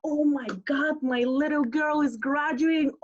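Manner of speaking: high, gushing proud father; excited dad. Retold or imitated. Drunk dad promising his daughter, gushing.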